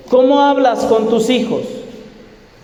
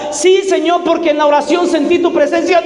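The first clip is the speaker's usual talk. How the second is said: grito agudo y fervoroso; Pedro exclamando; 6 semitones higher, faster than usual. Acted. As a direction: exclama con ansia, más agudo. Pedro insiste otra vez. (Pedro insiste gritando con fervor)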